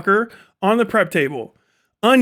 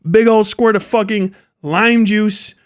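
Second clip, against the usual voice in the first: ordinary delivery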